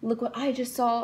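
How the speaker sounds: calling mom over and over, drawn out; excited calling for mom. Retold or imitated. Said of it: "I was just like".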